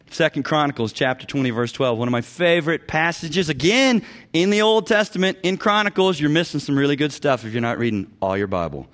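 ordinary talk

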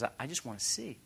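very soft, halting request; humble plea. Acted. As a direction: barely voice a simple wish. Voicing the blind man's plea.

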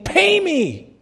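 sudden high, strained snarl; harsh demand. Acted. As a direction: choke the words out at him. Servant choking his debtor.

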